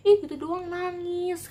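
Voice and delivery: high, slow, sneering taunt; mocking bully taunt. Bully mocking the crying girl, slow high sneer. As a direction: drag it out to mock the crybaby.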